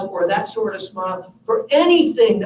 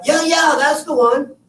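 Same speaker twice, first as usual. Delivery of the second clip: higher, louder, smiling yes-yes; cheerful nurse; 7 semitones higher, 4 dB louder than usual. Retold or imitated. Nurse assuring the son it's his mom, bright and high. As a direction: confirm it brightly like a grinning nurse.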